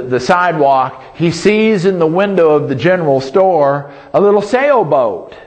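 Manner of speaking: ordinary talk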